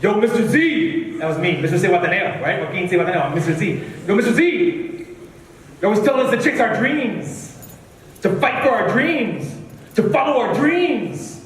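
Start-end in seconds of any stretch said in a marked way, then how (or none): 0.0-1.2 a hint of a big student calling his teacher; slow, drawn-out imitated greeting; lightly voiced student. Marco hailing his visiting poetry teacher, lightly imitated student voice. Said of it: "he's like". 4.1-11.4 imitated student, higher voice climbing as he goes; Marco. Student telling his poetry teacher what he sees, imitated earnest voice. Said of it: "he's like".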